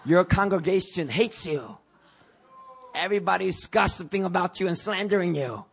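accusatory. Acted. Devil accusing a pastor of being disliked, accusatory. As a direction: needle them with each accusation. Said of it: "it's accusatory".

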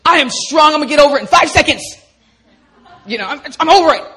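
high, loud, hurried bravado; over-the-top insistence on being strong; defiant boast. Retold or imitated. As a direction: declare it at full volume, racing through. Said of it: "'cause I was like".

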